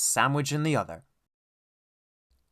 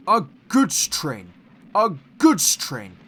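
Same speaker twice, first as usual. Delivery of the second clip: slow, louder disgusted grumble; grumbling; 2 semitones higher, 8 dB louder, slower than usual. A narrator's reading. Gordon hauling dirty coal trucks, grumbling slowly. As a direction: grumble it over and over. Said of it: "he grumbled".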